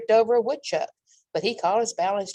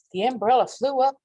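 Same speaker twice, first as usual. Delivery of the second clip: plain reading voice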